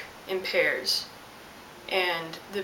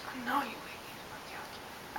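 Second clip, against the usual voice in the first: much softer, menacing whisper; whispered thought; 3 semitones higher, 14 dB quieter than usual. Retold or imitated. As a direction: hiss the unspoken threat under your breath. I didn't say that.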